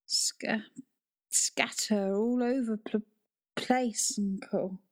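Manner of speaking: weak broken whisper; faltering whisper. Acted. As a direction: struggle to get words out. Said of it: "after her third attempt at speaking".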